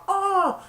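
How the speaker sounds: high imitated crowd "oh"; cheerleaders' cry. Cheerleaders seeing the fumble, high cry. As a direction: let out a high reactive cry. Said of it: "And the cheerleaders went".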